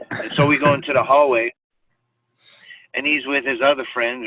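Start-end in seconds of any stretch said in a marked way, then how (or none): none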